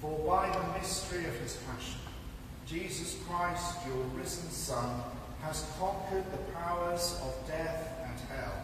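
ordinary talk

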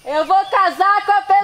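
shouting with joy, high; joyful shout. Acted. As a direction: yell it out happily to the sky. Acted proposal scene shout.

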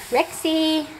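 high sing-song call to the dog; high pet-calling voice. Real, not acted.

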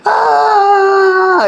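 a sudden high howl of a cry; howling wail. Retold or imitated. അലറാനും തുടങ്ങി.

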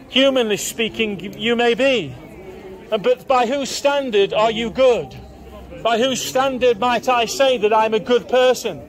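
ordinary talk, nothing marked

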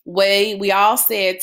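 usual speaking voice

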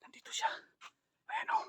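breathy hushed whisper; whispering while hiding. Real, not acted. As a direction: whisper so he can't hear you. Spots him while hiding.

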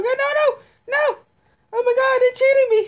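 falsetto shriek of disbelief; shrieking jackpot winner. Real, not acted. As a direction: shriek high and loud in outrage. Losing round, shrieking in falsetto.

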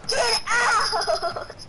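high, loud mocking shout through laughter; laughing mock yell; imitated yelling. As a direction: mimic the yell while cracking up. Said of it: "Because you're yelling".

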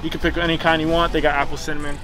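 ordinary talk

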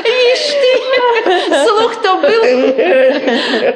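laughing. Acted. Gossip about the wedding.

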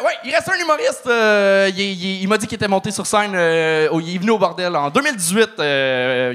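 ordinary talk, nothing marked

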